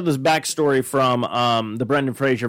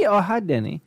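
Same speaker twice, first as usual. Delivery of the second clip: put-on higher, accented cheery greeting; Tommy Wiseau impression; 6 semitones higher than usual. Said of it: "only a select few will understand".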